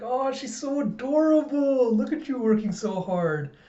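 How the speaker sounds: very high, drawn-out cooing; gushing adoration. Acted. Colleagues fuss over the doctor's daughter, gushing. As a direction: coo over an adorable little kid. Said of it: "And they're all like".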